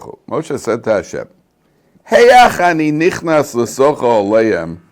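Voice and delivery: teacher reading Rashi aloud to his students, fervent; fervent text reading. Acted. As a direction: declaim the text to the class with force. Reads Rashi in class.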